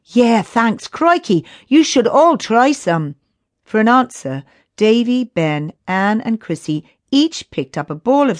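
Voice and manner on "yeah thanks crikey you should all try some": put-on high, cheeky excited boy. A narrator's reading. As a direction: toss it off cheekily. Billy cheeky after rescue.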